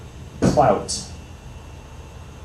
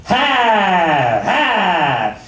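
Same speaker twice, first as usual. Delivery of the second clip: a scornful "Ha! Ha!" with a sour grimace; dismissive dad scoff; 6 semitones higher than usual. Retold or imitated. Comic imitating his dismissive dad, scornful scoff. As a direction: scoff it off like biting a lemon. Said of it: "you have to really furrow your brow".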